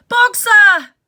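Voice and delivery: loud high desperate call; crying out. Acted. Clover chasing the van, desperate loud cry. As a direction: call after the moving van. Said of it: "she cried".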